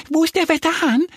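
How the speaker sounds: quick, very high squeaky question; excited little duck. A narrator's reading. Little duck asks the hens for the weathercock, excited. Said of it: "fragt Annie aufgeregt die Hühner".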